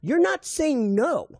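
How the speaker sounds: high, slow gotcha accusation; accusing. Retold or imitated. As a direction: call her bluff slowly. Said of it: "I go".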